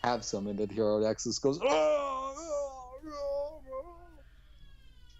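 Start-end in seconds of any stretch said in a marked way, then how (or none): 1.6-5.2 very high, dying cries trailing into silence; a hammed-up video game death wail; Solid Snake death imitation. Joking to his friend, a hammed-up game death cry. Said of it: "my little Solid Snake death imitation".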